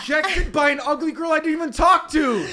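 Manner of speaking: hammed-up outraged shout at being rejected; exasperated shout. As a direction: blast it out like you can't believe it. Retelling his frustrated reply.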